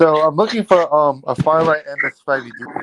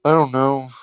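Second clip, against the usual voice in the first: ordinary talk, nothing marked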